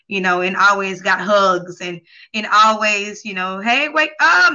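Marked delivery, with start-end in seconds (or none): 3.6-4.5 higher, louder mock wake-up call; imitating a parent waking someone; parent's wake-up call. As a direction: call out to rouse a sleeping child. Voicing a parent waking a child.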